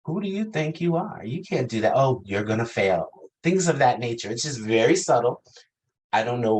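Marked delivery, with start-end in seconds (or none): 0.0-3.1 high, snide inner critic; bitchy queen voice. Retold or imitated. Inner critic mocking the speaker, in a high snide voice. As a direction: sneer it like a catty queen. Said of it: "my bitchy queen says things like".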